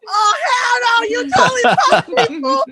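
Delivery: high, loud, laughing shriek of joy; joyful shout. Retold or imitated. Mom shrieking at daughter who faked failing, joyful. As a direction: scream it, thrilled and half crying. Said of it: "And I was like".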